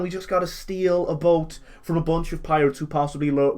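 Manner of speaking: usual speaking voice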